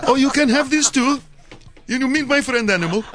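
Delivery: put-on high, comic Indian accent. Taxi driver introducing his friend Animal, Indian accent. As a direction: pitch it up as a mock Indian driver. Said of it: "You went to India".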